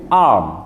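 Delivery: arm with drawn-out A; long A in arm. Shown as a demonstration. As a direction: model a long A in arm for repetition. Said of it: "bacaannya agak panjang sedikit".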